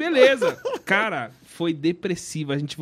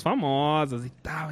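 ordinary talk

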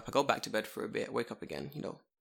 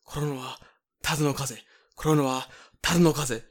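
imitated repetitive chant, building louder; getting hyped up; 3 dB louder, faster than usual. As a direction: start level, work up to a louder chant. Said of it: "Getting really hyped up".

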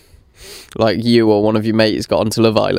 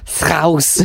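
"Scouse" pitched higher in an exaggerated Scouse accent; Scouse accent retry; 6 semitones higher than usual. Shown as a demonstration. Second attempt at a Scouse accent, higher. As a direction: have another go at a Scouse "Scouse". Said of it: "I can't do it".

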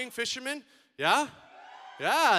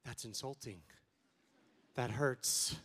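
mock hurt; 9 semitones lower, 9 dB quieter, slower than usual. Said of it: "And then I tell them".

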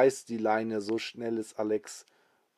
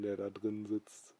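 ordinary delivery, nothing marked

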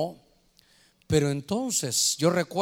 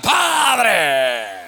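a big praying voice roaring over the others; booming shout; 7 dB louder than usual. Retold or imitated. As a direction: bellow it like a man drowning out the room. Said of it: "y el de la par".

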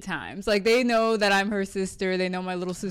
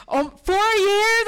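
high, slow incredulous echo; mimicking the church member's shock; mock disbelief; 12 semitones higher, 5 dB louder, slower than usual.

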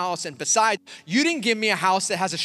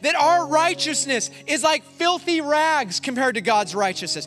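high, driving sermon delivery; emphatic preacher building a point; impassioned preaching; 8 semitones higher, 3 dB louder than usual. Real, not acted. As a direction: press the confession home with conviction.

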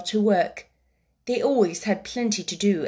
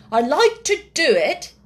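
put-on high, loud, eager boy's voice; earnest young boy Colin; 6 semitones higher, 6 dB louder than usual. Boy explaining his magic lectures, high and earnest. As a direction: play a self-important young lecturer.

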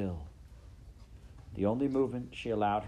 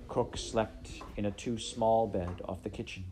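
ordinary delivery

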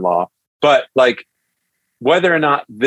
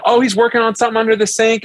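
high, fast and cheery; bubbly mother answering the door, quick; chirpy mom voice; 11 semitones higher, faster than usual. Retold or imitated. Mother-in-law greets nervous suitor, bright and high.

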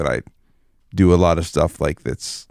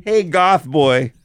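higher, slow drawn-out call; calling kids to dinner; 9 semitones higher, much slower than usual. Pretend. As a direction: call across the yard, stretching it out. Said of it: "you just open the cardboard door".

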